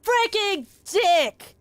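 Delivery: screamed way up high, stretched out in fury; frustrated screaming. Real, not acted. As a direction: howl it out at the game, beside yourself. Raging at a running puzzle.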